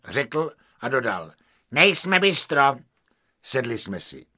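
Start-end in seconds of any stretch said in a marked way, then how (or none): 1.7-2.7 1 haughty. Acted. Haughty waiter.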